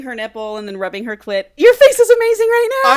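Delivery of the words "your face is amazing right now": very loud, high-pitched burst of laughter; laughing shout. Real, not acted. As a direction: shriek it through laughter. Cracking up at co-host's reaction.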